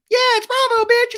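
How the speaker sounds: very high, loud squeaky cartoon voice; hammed-up tiny [name] squeal; little [name] voice. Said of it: "It's me, little [name]".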